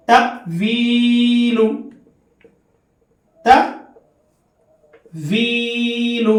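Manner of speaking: تأویل with a sharp glottal catch, stretched slowly; hamza catch. Shown as a demonstration. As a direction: jolt the hamza, then draw the vowel. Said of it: "اور ایک مثال آپ حضرات کے سامنے دوں". (تأویل کو جھٹکا دے کر لمبا پڑھیں)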